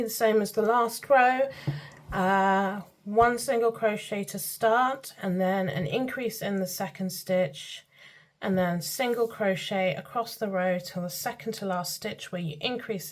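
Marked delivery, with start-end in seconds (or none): none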